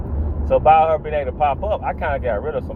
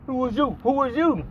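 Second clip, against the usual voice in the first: high, lightly voiced demanding boyfriend; a hint of threat in a raised voice; menacing ex; 9 semitones higher, 4 dB quieter than usual. The ex confronting the man coming out of the closet, high and demanding.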